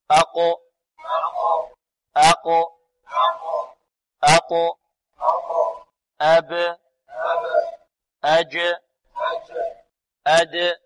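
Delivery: loud bouncing echo released on each final consonant of أقو، أب، أج، أد; qalqala drill. Shown as a demonstration. Teacher has the class repeat, loud qalqala drill. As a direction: punch out أب، أج، أد with a short bounce after the stop. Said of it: "انطقوا معي".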